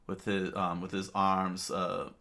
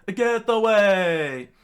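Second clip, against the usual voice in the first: slow, much louder, higher scream; screaming warning; 13 semitones higher, 13 dB louder, much slower than usual. Acted. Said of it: "Chopper screamed out to Luffy".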